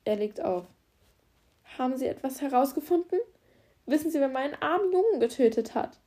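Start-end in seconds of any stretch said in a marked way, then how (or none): 1.8-6.0 higher, louder, hysterical hope; cling desperately to any news. Acted. Mother to the officer after his phone call, hysterical hope. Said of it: "Die Hysterie und die Hoffnung in ihrer Stimme".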